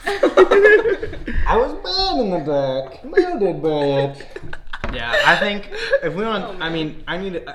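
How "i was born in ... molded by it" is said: very slow masked villain voice, breaking into a laugh; the Bane line again, slower and half laughing; Bane impression. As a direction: stretch every word out like a movie villain. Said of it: "so that's Bane from Batman".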